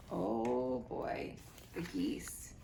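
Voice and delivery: ominous whisper; a hushed, foreboding aside. Real, not acted. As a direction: breathe it under your breath.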